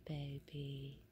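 low, quiet, tender; soft murmur. Real, not acted. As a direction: breathe it out gently. Soft call to the pet.

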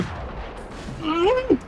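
high, soft incredulous laugh; disbelieving laugh. Real, not acted. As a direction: laugh in despair. Gamer dies again unexpectedly.

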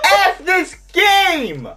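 slow, drawn-out angry yell; raging shout. Real, not acted. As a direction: let the fury stretch out. Gamer raging at missed shot.

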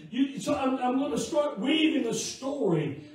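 loud, impassioned pulpit delivery; full-force preaching. Real, not acted. Preacher testifies to the congregation how God provides, loud fervent preaching. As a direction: preach it out loud, driving toward each amen.

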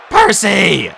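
loud, high cry for a friend; urgent call. A narrator's reading. Grover calling the hero to a fire, high urgent shout. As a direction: shout the name for help.

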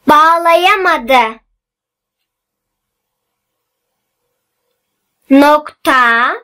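slow, word by word with long pauses; dictating. Shown as a demonstration. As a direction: read it out slowly for pupils to write down. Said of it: "Ben metni yavaş yavaş okuyacağım".

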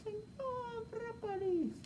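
very high, soft, slow puppet voice. Acted. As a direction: squeak the broccoli puppet's name softly. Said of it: "Con la ayuda de". (voz aguda de títere)

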